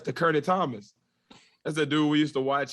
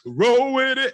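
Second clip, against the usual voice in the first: loud and high, playful; excited; 11 semitones higher, 6 dB louder, slower than usual. Pretend. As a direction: shout it back gleefully. Echoing cohost playfully.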